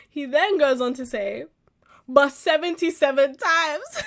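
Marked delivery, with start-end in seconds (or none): 2.1-4.0 laughing disbelief. Real, not acted. Laughing at the verse.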